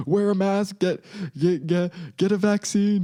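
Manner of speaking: hammed-up scold telling everyone to mask up; mocking preachy voice. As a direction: parody the preachy lecture. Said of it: "Meanwhile, they, they're".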